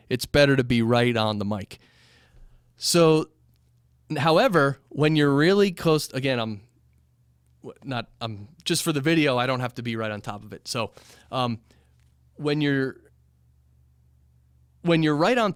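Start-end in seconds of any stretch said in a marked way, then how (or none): none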